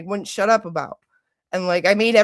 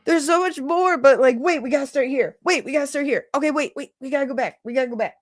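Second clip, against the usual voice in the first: high-pitched, frantic restarting; mock overwhelmed; 5 semitones higher, faster than usual. Acting out trying to explain the case, overwhelmed. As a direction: act out being swamped by too much to explain. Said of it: "it's just impossible".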